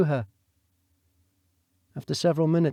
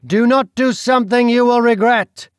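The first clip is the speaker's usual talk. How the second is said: loud, higher menacing warning; threat; 8 semitones higher, 8 dB louder than usual. Acted. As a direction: threaten her with consequences. Duke threatens.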